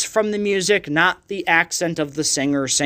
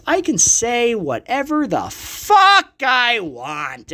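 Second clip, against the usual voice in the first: slow, higher-pitched rant breaking into a shout; defiant shout; 5 semitones higher, slower than usual. Real, not acted. Podcaster insisting on his right to rant, defiant shout. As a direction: draw it out and belt the swear word.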